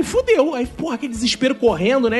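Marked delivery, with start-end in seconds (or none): 0.0-0.5 high panicked fudeu; high-pitched fudeu. Retold or imitated. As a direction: squeak it out in panic. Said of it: "Aí eu falei".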